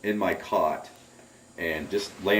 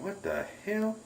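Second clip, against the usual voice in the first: soft, high, wondering question; hushed high-pitched puzzlement; retold bewilderment; 12 semitones higher, 7 dB quieter, slower than usual. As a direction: act out stunned disbelief under your breath.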